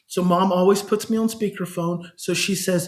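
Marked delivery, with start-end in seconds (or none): none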